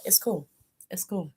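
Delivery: a quiet, tight brush-off; quiet forced calm. Retold or imitated. Tae quietly brushing off an apology on camera, hushed. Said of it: "he was like".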